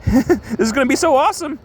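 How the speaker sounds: very high, gleeful cartoon villain voice; Joker. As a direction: answer in a giddy, squeaky put-on pitch. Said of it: "He's like".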